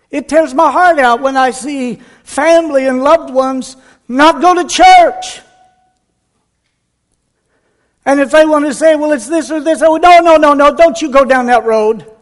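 builds from a plea to a high, loud outcry; rising preacher's cry. Acted. Pastor warning his flock off a road: swelling into a loud cry. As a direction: let it swell until you're crying out oh no, no.

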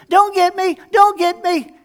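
high, loud mock pleading; feigned fear. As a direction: squeal it like a scared victim. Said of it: "I would shrink down".

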